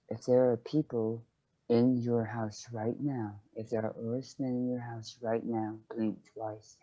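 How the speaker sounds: hushed, secretive undertone; very quiet. Acted. Leaning in to secretly ask Reuven, very quiet. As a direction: say it low and very softly, for one person's ears. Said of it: "he was saying it very quietly".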